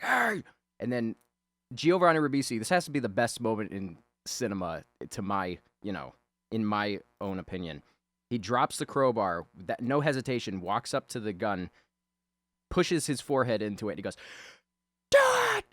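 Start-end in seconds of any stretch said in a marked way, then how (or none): 0.0-0.4 high-pitched warning yell; imitated call. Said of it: "He's like". 15.1-15.6 very high, loud imitated scream; yelling. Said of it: "he just starts yelling out".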